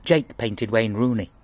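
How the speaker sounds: ordinary delivery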